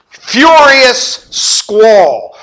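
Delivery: slow, loud and drawn out; furious squall. Acted. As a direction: let the storm roar in the words. Preacher dramatizes storm.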